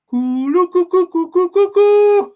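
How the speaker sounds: high, loud ululating whoop; battle cry. Acted. Proudly showing off his Canada Man battle cry, a loud whoop. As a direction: let rip a wild trilling war whoop. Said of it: "You know what my battle cry is?".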